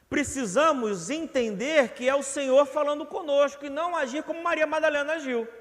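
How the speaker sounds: high, loud rising sermon push; fervent preaching. Acted. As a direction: preach it hard to the congregation. Preaching at full force. (pregação fervorosa, alta e intensa)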